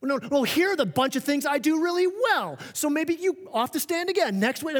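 high, indignant self-defence; mock courtroom defence. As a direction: argue your case like an overeager lawyer. We treat it like this courtroom.

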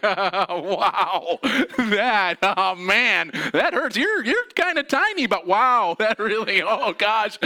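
high, loud, strained laughter while hurting; laughing through pain. Retold or imitated. To his tiny therapist, laughing high and loud through the pain. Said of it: "I laugh. so I'm like".

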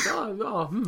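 lightly voiced mighty being pondering; all-powerful sorcerer. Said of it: "kann alles entscheiden und sagt".

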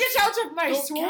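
loud, high Scottish ogre shout; Shrek impression. Player quotes Shrek at intruders, shouted Scottish accent. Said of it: "You turned into Shrek".

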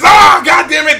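exasperated comic shout; acted exasperation. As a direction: curse in hammed-up frustration. Acting Deadpool's frustration at the rating.

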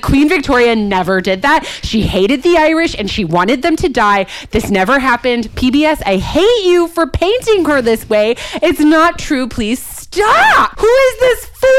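building from loud to a high shout; ranting at the TV. Retold or imitated. Venting at PBS over history, building to a shout. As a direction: escalate into an outraged yell. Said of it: "And I'm like".

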